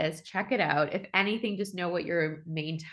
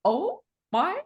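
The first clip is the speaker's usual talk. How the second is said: mock realization; 8 semitones higher, 6 dB louder, much slower than usual. Realization retold, drawn out.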